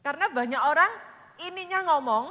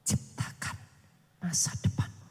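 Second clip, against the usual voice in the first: hushed urging; whisper; 8 semitones lower, 3 dB quieter than usual. Acted. Preacher urges the congregation in a hush. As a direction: breathe the command softly.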